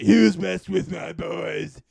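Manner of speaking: loud, slow bellow; gang leader shout. Retold or imitated. Gang boss storms out at the hero, slow loud shout. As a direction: boom it like a huge boss storming out.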